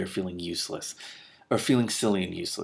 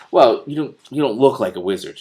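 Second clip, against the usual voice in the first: put-on louder doubting girl; 4 semitones higher, 10 dB louder than usual.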